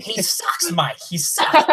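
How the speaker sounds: high-pitched mimicry of a sports pundit; Tony Maserati impression. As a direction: do a squeaky, hammed-up radio-host impersonation. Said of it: "that's my Maz impression".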